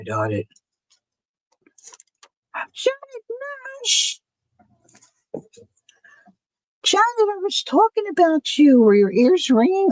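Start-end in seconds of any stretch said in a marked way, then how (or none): 2.7-9.9 high, delighted call of a name; light up and call out to her; excited greeting. Real, not acted. Greeting a viewer who arrived.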